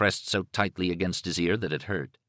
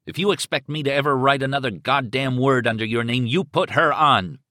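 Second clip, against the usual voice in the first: furious ultimatum; 6 semitones higher, 5 dB louder than usual. Acted. Husband threatening the kidnapper over the phone, furious. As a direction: lay down the threat, seething.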